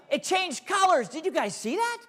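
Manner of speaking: gasping, wide-eyed showman wonder; mock amazement. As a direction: sell the trick with a big gasp. Magician fakes amazement for kids.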